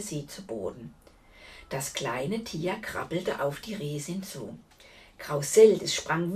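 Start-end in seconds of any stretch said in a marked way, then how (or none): none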